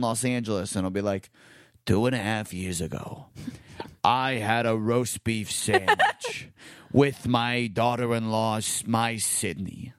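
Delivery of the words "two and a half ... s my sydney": imitating dad. Dad's text read in his voice.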